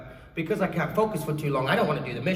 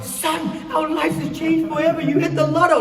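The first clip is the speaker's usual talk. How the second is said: hammed-up jackpot excitement; over-the-top elation; 12 semitones higher, 9 dB louder than usual. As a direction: gush like an overjoyed mother, hammed-up. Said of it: "there was no like".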